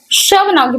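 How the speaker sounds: usual speaking voice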